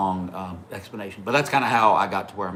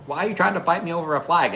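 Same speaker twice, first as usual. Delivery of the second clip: higher, quicker incredulous protest; retold protest; 6 semitones higher, faster than usual. Rider answering three kids picking a fight, incredulous protest. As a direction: question them in mock disbelief, pitched up.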